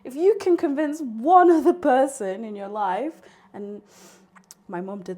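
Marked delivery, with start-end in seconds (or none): none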